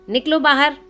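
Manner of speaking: loud call to get out. A narrator's reading. Nimai ordering the girls out, loud call. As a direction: call them out of the water. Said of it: "निमाई सबको बोले".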